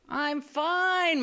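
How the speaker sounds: lightly voiced injured father, breezy; dad's brave reply. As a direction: play down the injury cheerfully. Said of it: "my dad said".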